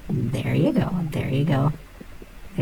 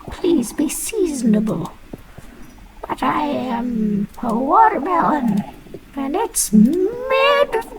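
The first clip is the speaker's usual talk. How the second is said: high, slow, squeaky fruit voice pleading to be in season; a lightly voiced indignant watermelon; squeaky melon voice; 5 dB louder, slower than usual. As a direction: talk as a cartoon watermelon caught out of season.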